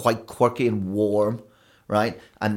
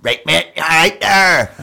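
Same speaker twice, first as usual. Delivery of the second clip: higher and louder, broad thick Northern Irish; thickest Northern Irish accent; 8 semitones higher, 9 dB louder, slower than usual. Retold or imitated. Showing the accent she had on moving over, thick Northern Irish. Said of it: "I had the thickest".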